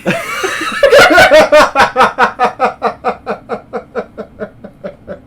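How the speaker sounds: high, loud laughter trailing down slowly; long helpless cackle, gradually fading; big laughing fit. Real, not acted. Cracking up at own joke.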